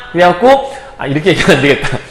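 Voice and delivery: ordinary delivery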